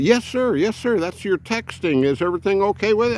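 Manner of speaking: quick, eager customer service voice; phone clerk voice. Retold or imitated. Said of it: "he said".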